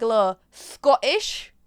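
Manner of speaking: a hint of a Scottish accent; playful Scottish accent demo. Shown as a demonstration. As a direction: lightly put on a Scottish accent. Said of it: "maybe I could give you a little bit".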